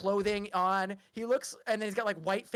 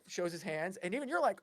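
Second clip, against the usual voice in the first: usual speaking voice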